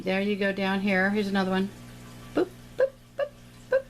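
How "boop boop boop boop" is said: high playful boops while dabbing; little boop noises. Pretend. Teacher makes little noises for each painted leaf, high playful boops. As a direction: pop out tiny high boops with each dab. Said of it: "you gotta do the little noises".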